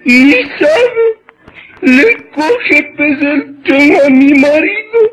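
high, slow hammed-up sobbing; mock wailing lament. Neighbor tells neighbor of husband's death, mock crying. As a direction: wail it like a grieving widow, overdone.

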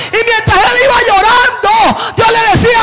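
loud, high shouted preaching; preaching at full force. Acted. Preacher urging the congregation to come hungry, shouted fervent preaching. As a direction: build it into a fiery climax.

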